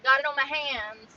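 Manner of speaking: loud annoyed lament; dismayed. Real, not acted. Dismayed spill complaint.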